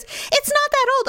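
high, protesting imitation; mock defensive husband. Retold argument over old keyboard.

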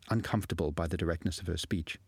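usual speaking voice

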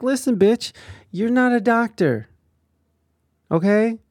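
loud high sassy put-down; angry retort. Pretend. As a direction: snap it like telling him off. Mocking Cosby's self-title.

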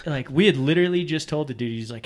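ordinary talk, nothing marked

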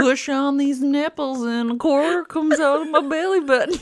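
put-on high Southern accent through laughter. Explaining the belly-button dispenser in a Southern accent. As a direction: keep the Southern voice, giggling. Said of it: "Southern accent came from".

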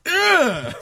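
high, sudden mock grunt; cocky imitated kid's grunt. Co-host imagines toddler's rude gesture, mock grunt. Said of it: "was like".

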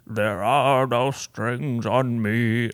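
slow, lightly voiced robot villain line; Ultron quote. Quoting Ultron to her listeners in a slow mock villain voice. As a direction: drawl it out like a mock movie villain. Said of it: "Age of Ultron quote when he's like".

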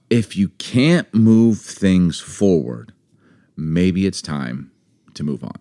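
"can't" stretched out, very slow and weighty, fading on "move on"; slow emphatic. Shown as a demonstration. Pausing to stress the book's key idea, slow and heavy. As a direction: drag each word slowly to drive it home. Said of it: "And that thought".

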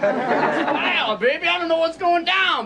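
high laughing jive talk; high hepcat voice laughing; hip jive voice. Acted. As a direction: play it cool and hip.

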